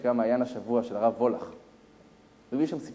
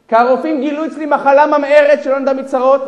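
loud, raised voice, easing at the end; acted forceful confession; impassioned revelation; 12 semitones higher, 17 dB louder than usual. Dying millionaire explaining his illness, impassioned.

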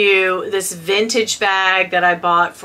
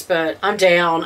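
ordinary talk

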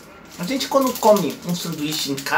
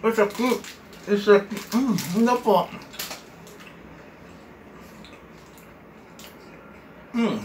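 talking while chewing food; muffled by wafer bite; mouth full, chewing; 3 semitones higher, 4 dB quieter than usual. Real, not acted. Seen 0: Hand at mouth, chewing the wafer snack while speaking.

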